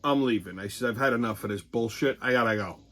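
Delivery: low, soft deadpan; flat calm exit. Retold or imitated. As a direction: say it low and unbothered. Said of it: "I says".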